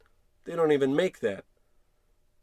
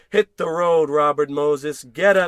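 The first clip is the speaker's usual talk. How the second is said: louder, rising tirade; building rant; 8 dB louder than usual. Acted. George ranting to Marge about rejection letters, loud. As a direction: let the rant boil over.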